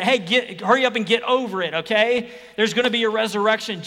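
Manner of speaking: loud, fast, impatient; dismissive brush-off. Pretend. Preacher mocking Christians who hurry mourners along, loud and fast. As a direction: rush them past grief, impatient.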